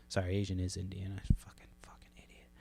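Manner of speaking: a quick self-scolding aside in a whisper; muttered whisper. Real, not acted. Podcast host corrects his own slip, whispering to himself. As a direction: whisper it under your breath, annoyed at yourself.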